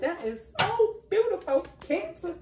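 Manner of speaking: high, soft, choked-up voice; moved to tears by the beauty of it; real emotion rising, voice lifting and softening; getting emotional. Real, not acted. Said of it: "I'm getting all emotional".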